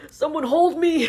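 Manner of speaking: loud, high theatrical cry; desperate plea. Acted. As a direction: beg dramatically to be held. Sudden needy outburst about touch.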